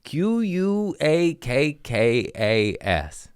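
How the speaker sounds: Q U A K K A S spelled letter by letter, slow; spelled out slowly. Shown as a demonstration. Comic spelling out the animal's name, slowly letter by letter. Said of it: "Add one more K".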